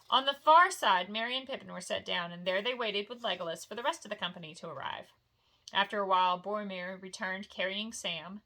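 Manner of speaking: plain reading voice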